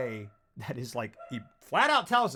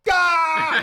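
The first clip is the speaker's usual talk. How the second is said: overwhelmed cry; 13 semitones higher, 13 dB louder than usual. Retold or imitated. Retold reaction to the scene.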